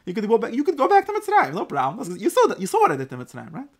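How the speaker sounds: loud, high-pitched acted threat; mock threat. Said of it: "beautifully veiled threat".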